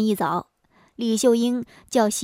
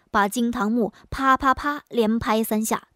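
ordinary delivery, nothing marked

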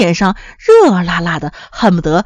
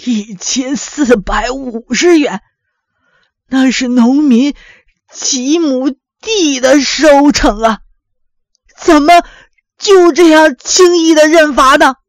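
agitated; 3 semitones higher, slower than usual. A narrator's reading. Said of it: "老父亲激动地说". (老父亲激动地一字一顿，越说越高声)

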